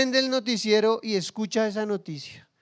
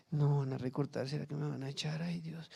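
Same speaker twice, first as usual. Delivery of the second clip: softer, anxious, fretting under the breath; mock fretful worker fearing the sack; worried inner voice; 6 semitones lower, 14 dB quieter than usual. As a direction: quietly panic about losing the job.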